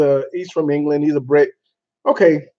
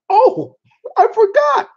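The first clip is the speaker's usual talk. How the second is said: sudden remembering; 4 dB louder than usual. Real, not acted. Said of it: "sudden recall".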